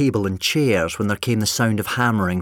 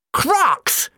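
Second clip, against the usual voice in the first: very high, loud outburst; sudden shout; 4 dB louder than usual. A narrator's reading. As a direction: blurt it out in a sudden flash of insight. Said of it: "the doctor suddenly shouted".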